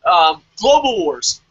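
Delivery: ordinary talk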